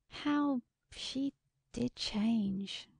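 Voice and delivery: very slow, wistful wonder; slow, regretful reflection; wistful musing. A narrator's reading. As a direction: draw out each word, lost in thought. Musing sadly on the lost friend.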